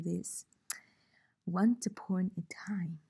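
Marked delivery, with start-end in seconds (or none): 1.5-3.0 dreamy storyteller whisper. Pretend. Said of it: "Did you say time".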